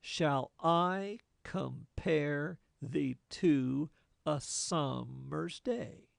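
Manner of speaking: slow, measured beat with weight on "compare" and "summer's"; slow da-DUM rhythm on "Shall I compare the two a summer's day"; iambic reading. Shown as a demonstration. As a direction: read it slowly so every second syllable lands. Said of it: "it might read something like this".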